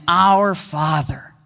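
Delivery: "Our Father" drawn out very slowly; slow, reverent "Our Father"; slow and savoured. Shown as a demonstration. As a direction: let "Our Father" soak in, word by word. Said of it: "soaking ourselves in".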